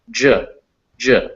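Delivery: "J" as D plus zh, as in Jeep; English J sound. Shown as a demonstration. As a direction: sound out "J" as a short juh, not the letter name. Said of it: "take a D and a zh".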